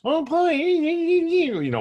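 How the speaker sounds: very high, loud squeaky cartoon squeal; funny cartoon voice. Shown as a demonstration. Giving the inner critic a funny voice, very high and squeaky. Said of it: "just giving it a funny voice".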